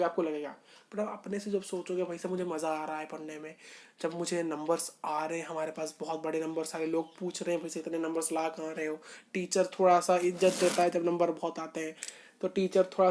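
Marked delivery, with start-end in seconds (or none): 2.0-9.0 low, hushed self-talk; imagined inner thought. Acted. Teacher voicing a student thinking to himself about enjoying study, quiet self-talk. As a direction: voice a student's private thought, softly and lower.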